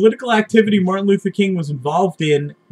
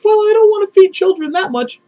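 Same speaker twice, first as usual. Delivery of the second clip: high, whiny wimpy voice, much higher; mock timid liberal; 12 semitones higher than usual. Mocking a liberal backing down from feeding children, whiny falsetto. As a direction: shrink back in a simpering falsetto.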